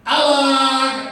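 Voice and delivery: high, loud reveal shout; triumphant ta-da. Pretend. Teacher revealing the stick trick to children, triumphant. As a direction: announce the trick like a magician.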